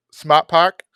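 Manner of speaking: a quick mock Boston accent, "Smart Park" with the r's dropped. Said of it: "my Boston accent isn't great".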